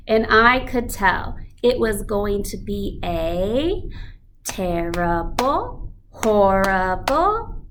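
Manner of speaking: lively storybook reading to a class; reading to children. Acted. Teacher reads the picture book to the class. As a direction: read it like a story for kids.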